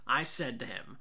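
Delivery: ordinary delivery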